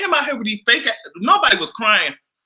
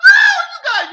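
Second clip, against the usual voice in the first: high loud delighted cry; delighted shout; 7 dB louder than usual. Real, not acted. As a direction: cry out at the chat. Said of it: "I am screaming".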